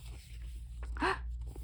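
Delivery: sharp gasp; surprised gasp. Acted. Surprise at news.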